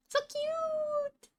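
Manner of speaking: squealed very high; cute anime streamer. Real, not acted. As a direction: squeal it like a fangirl. Gushing over wolf anatomy.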